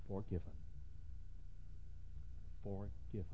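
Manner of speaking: soft, hushed repetition. Acted. Preacher hushing the word forgiven. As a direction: let the word fall soft.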